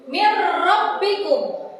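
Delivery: Mir rabbikum, the n merged into a doubled r, no nasal hum; slow, clear recitation without dengung; correct idgham bila gunah. Shown as a demonstration. Teacher recites Mir rabbikum for the class, slow and clear.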